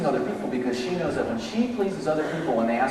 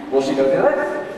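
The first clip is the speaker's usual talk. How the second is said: usual speaking voice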